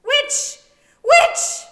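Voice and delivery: shrieked very high and very loud; screamed accusation. A narrator's reading. Merope shrieks at Hermione while clawing her throat. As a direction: scream it as you claw at her. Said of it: "Merope screamed".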